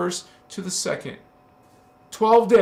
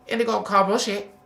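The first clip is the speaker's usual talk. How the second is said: lightly voiced parrot, quick and a bit higher; 3 semitones higher, faster than usual. A hint of parrot mimicking for viewers. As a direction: rattle it off like a mock parrot. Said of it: "I can't talk like a parrot".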